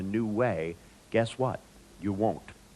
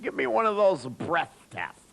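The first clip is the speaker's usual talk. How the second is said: put-on high, slurred drunk demand; hammed-up drunk, pitched high; drunk man; 9 semitones higher than usual. Drunk man demanding a test from the guard, high and slurred. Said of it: "still blasted out of their head".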